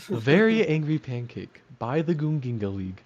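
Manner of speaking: lightly voiced picture-book narrator; storybook title reading. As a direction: read it like a children's book title. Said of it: "sounds like a children's storybook".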